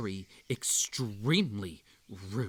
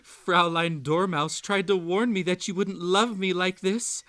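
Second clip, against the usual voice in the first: louder and higher, pressing every word; loud outburst; 5 semitones higher, 6 dB louder than usual. Acted. Character complains loudly that the Dormouse warned her. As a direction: burst out in a raised, rising voice.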